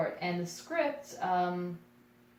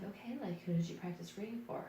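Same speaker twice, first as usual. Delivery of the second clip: soft imitation of the writer; hushed, slightly quick retold query; polite casting writer; 11 dB quieter than usual. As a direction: quietly voice the auditioner's question. Acting out the writer's quiet question.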